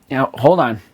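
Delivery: hoarse catch in the throat; frog in throat. Real, not acted. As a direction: voice catching, needs to clear throat. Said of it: "Got a frog in my throat".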